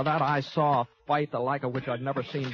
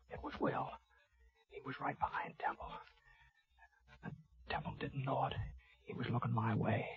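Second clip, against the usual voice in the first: whispered narration; 10 dB quieter than usual. A narrator's reading. Tense narration during an ambush.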